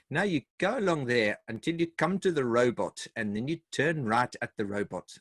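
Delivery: imitated South African direction-giver; South African accent. Local giving directions in Johannesburg, in a South African accent. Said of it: "have to do the South African voice".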